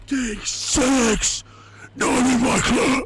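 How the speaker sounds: high, loud scandalized yell; outraged teacher shout. Retold or imitated. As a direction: shriek it like an appalled teacher. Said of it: "the teacher just being like".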